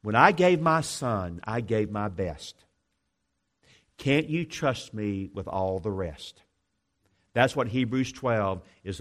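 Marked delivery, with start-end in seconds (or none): 0.0-6.4 tender voice of God. Acted. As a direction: speak as a loving father, slowly. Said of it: "this text is gonna say something like this".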